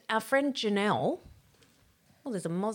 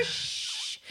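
hushing; 5 dB quieter than usual. Retold or imitated. Hushing husband.

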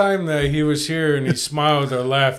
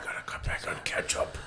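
hushed mock-ASMR whisper; 16 dB quieter than usual. Guest obeys a request for ASMR, whispering. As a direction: whisper it close and very softly. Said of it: "Whisper, do some A S M R".

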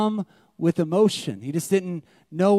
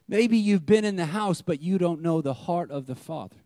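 usual speaking voice

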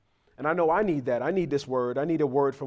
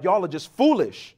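louder, higher retold scolding; exasperated scolding; 4 semitones higher, 4 dB louder than usual. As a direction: replay a parent's fed-up verdict. Replays scolding his children.

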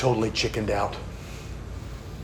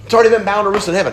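fast repeat; 8 semitones higher, 6 dB louder, much faster than usual. Shown as a demonstration. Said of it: "repeated faster for emphasis".

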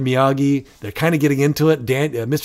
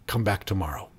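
low, soft, matter-of-fact teacher; understated, offhand; 5 semitones lower, 9 dB quieter than usual. Acted. Teacher after the pupil's huge realization, low offhand dismissal. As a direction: dismiss the pupil quietly, no big lesson. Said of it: "it's not some big life lesson".

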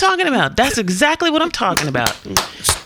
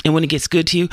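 usual speaking voice

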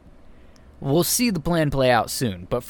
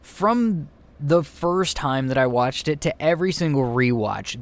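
ordinary delivery

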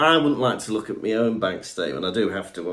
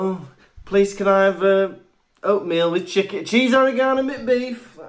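mock polite order; 6 semitones higher, 3 dB louder than usual. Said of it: "I thought".

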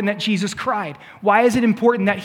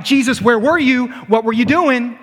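higher, louder demanding question; mock accusation; 4 semitones higher, 4 dB louder than usual. What Mary does not say to Jesus, mock angry. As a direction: confront him with blame. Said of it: "She's not like".

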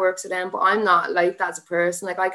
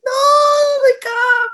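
much higher and louder, sobbing the words; crying; 7 dB louder, much slower than usual. Retold or imitated. Fighter refusing to stop, to coach Gav, crying out. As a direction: cry out his name, breaking.

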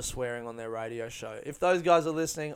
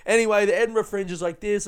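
glossing over it; 6 semitones higher, 6 dB louder than usual. Retold or imitated. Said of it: "I just try and gloss over it".

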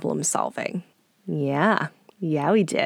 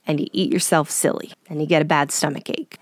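usual speaking voice

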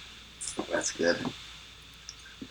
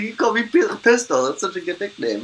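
eager high voice; 11 semitones higher than usual. Retold or imitated. Mimicking eager friend, high.

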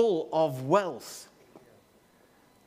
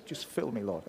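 mock prayer; 3 semitones lower, 6 dB quieter than usual. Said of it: "where I've seen some".

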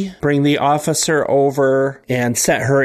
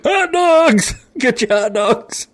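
hot dog hawker; 12 semitones higher, 5 dB louder than usual. Acted. Hawking hot dogs.

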